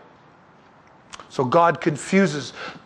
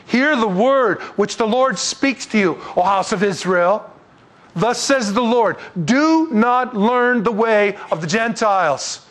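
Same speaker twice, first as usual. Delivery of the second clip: raised, slow, solemn reading of scripture; 4 semitones higher, slower than usual. Acted. Preacher reading Jeremiah aloud to the congregation, slow and solemn. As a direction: read the prophet's words out to the congregation.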